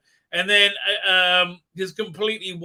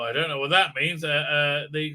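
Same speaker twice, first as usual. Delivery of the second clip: usual speaking voice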